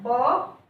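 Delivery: ब as the letter's sound; phonics B sound. Shown as a demonstration. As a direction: say ब as B sounds, not its name. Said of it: "बी का sound होता है".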